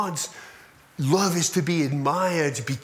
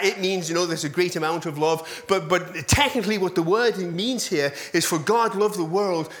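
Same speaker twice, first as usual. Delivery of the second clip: ordinary delivery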